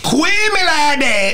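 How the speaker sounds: slow, high "Quit me like that" run into one word; mock a garbled rapper, stretching "Quit me like that"; slurred mimicry. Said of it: "It's not English".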